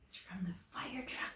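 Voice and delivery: hushed, unvoiced whisper like a secret. Real, not acted. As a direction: lean in and whisper the answer. Teacher reveals answer secretively.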